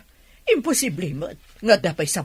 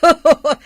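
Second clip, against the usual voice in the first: loud burst of laughter; 11 semitones higher, 11 dB louder than usual. Acted. Teasing flirt, big laugh.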